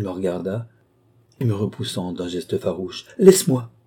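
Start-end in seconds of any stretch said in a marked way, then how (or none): none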